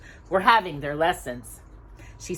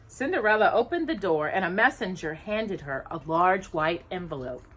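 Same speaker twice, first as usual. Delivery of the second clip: ordinary delivery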